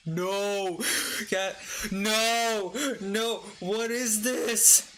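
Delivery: high-pitched, drawn-out protest; appalled exclamation. Real, not acted. Reader reacting to an outrageous review, appalled. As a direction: cry out in disbelief, pitched right up.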